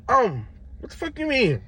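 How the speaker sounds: high incredulous what; a high mock disbelieving question; imitating a stunned clerk, high; baffled clerk.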